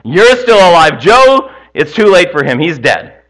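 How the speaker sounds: high, loud hellfire shout; preacher yelling. Acted. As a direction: thunder at the congregation like a revival preacher. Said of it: "I'm trying to yell at you guys".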